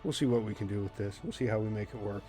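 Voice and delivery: normal talking voice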